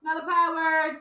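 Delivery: high-pitched sing-out; high classroom chant; calling out. Shown as a demonstration. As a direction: call the class in, high and stretched. Calls out a power word to class.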